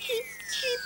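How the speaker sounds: very high chirping cheep; chick peep. Pretend. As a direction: squeak like a tiny chick. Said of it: "said the cat".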